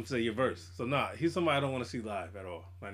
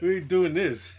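laughing; 5 semitones higher, 7 dB louder, slower than usual. Retold or imitated. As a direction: quote it while laughing. Repeated quote with a laugh.

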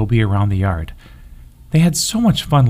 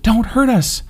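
much higher, tiny pleading bunny voice; frightened plea; 12 semitones higher than usual. A narrator's reading. Baby bunny begging the big puppy, frightened and high. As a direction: beg not to be hurt, small and scared.

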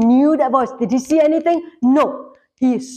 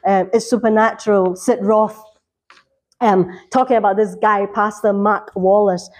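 ordinary talk

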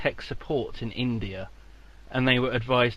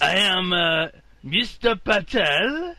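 put-on loud Indian accent drifting into French; botched Indian accent; 5 semitones higher, 5 dB louder, slower than usual. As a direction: play Indian tech support with a slow, high, badly faked accent. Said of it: "sound like you're in France".

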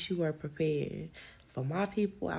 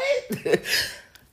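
very high loud laugh; shrieking laugh; 8 dB louder than usual. Real, not acted. Laughing at her own queen fantasy. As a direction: cackle high with glee.

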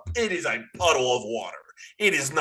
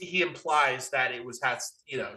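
ordinary delivery

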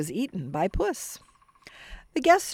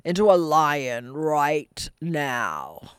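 slow, heavy ogre boast; growling ogre; 2 semitones lower, slower than usual. Acted. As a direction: drag it out like a smug brute. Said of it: "growled the ogre".

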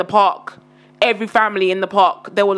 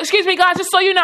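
mock calling out; 8 semitones higher than usual. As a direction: imitate her hailing everyone, pitched up. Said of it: "Just shout".

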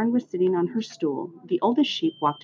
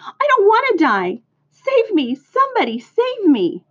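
high, loud pleading cries; panicked scream; 4 dB louder than usual. A narrator's reading. Pig begging anyone to save his life, screamed. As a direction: narrator acting a frantic squeal for help. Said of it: "screamed Wilbur".

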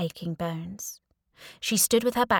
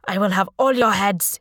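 loud royal threat; imperious, raised put-on voice; angry Sultan; 6 dB louder than usual. A narrator's reading. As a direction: threaten them as an outraged ruler. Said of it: "drowsiness replaced by anger".

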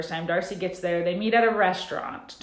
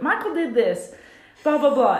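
higher mock voice of the friend, louder on the blahs; 6 semitones higher, 5 dB louder than usual. Friend telling Angela what Michael did, lightly voiced and high. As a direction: toss it off in a high gossipy voice. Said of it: "I can't even do it".

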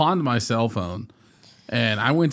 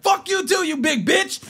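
much higher, pumped-up yell at him; hyped-up taunt. Retold or imitated. Retelling how he yelled at the big pawn shop guy, hyped shout. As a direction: throw the insult up high, all hyped.

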